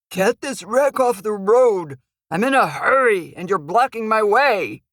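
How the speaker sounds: a rough tough guy barking orders; gravelly thug. Acted. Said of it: "He says in a gravelly voice".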